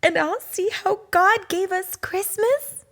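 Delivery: a small cub asking mama excitedly; high excited cub voice; hopeful little cub. Acted.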